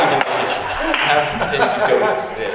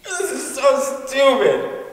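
slow sulky line; 4 semitones higher, much slower than usual. Acted. Sulky line in acted scene.

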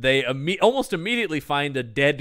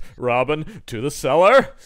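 mock Batman call; 7 dB louder than usual. Batman parody line.

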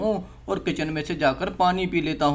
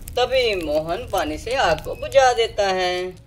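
usual speaking voice

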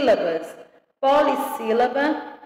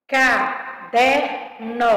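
very slow "Caderno", ca-der-no in separate beats; syllable by syllable; much slower than usual. Shown as a demonstration. Teacher giving a three-syllable example, syllable by syllable. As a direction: count out each syllable of "Caderno". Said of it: "Três sílabas".

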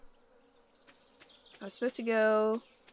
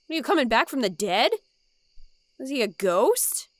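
excited disbelief; 3 semitones higher, 8 dB louder than usual. Real, not acted. Reacting to grandpa's note.

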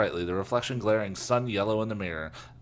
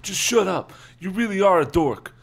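ordinary delivery

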